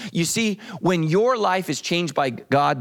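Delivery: normal talking voice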